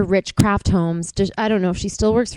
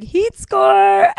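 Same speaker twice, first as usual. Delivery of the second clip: mock slang; 10 semitones higher, 6 dB louder than usual.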